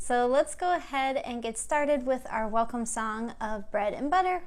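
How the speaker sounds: normal talking voice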